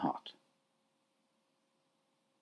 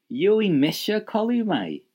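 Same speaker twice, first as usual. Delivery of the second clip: "misha" stressed high and loud, slowly; slow, loud, high-pitched emphasis on "misha"; emphatic Irish; 11 semitones higher, 6 dB louder than usual. Shown as a demonstration. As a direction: insist on "misha", drawn out and lifted. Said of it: "let's put emphasis on it".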